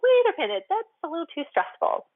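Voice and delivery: high, squeaky put-on voice of her alarmed body. Her body voiced as a squeaky, panicked little figure. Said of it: "my body was just like".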